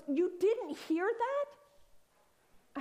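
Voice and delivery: can't believe she missed it; incredulous. Retold or imitated. Husband asking Karen in disbelief, drawn-out and high.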